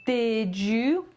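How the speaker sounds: usual speaking voice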